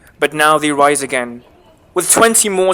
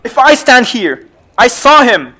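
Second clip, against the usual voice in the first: higher and louder, desperate; insistent outburst; 6 semitones higher, 8 dB louder than usual. Acted. Macbeth insisting to his wife he saw him, shouting. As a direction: swear it out in fear.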